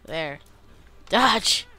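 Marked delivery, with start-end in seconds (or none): 1.1-1.7 high, loud, sudden command; urgent shout. Real, not acted. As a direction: call out a quick warning. Calling commands while playing.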